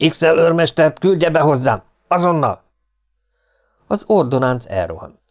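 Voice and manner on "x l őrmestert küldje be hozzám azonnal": high-pitched, furious officer's command; raised, impatient military command; roared order. A narrator's reading. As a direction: bellow an urgent order at a subordinate. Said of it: "Ráordított a belépő ordonáncra".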